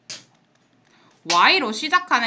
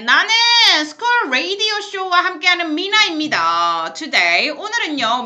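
radio host; 7 semitones higher, 6 dB louder than usual. Acted. Radio host intro in Korean.

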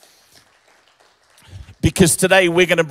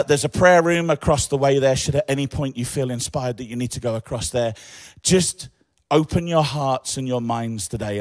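ordinary talk

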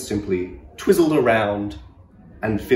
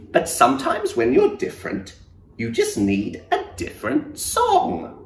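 high, gentle cricket voice; little cricket voice; 8 semitones higher than usual. Acted. Cricket advising the sad giraffe in a high gentle voice. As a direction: lift it up into a tiny kind voice.

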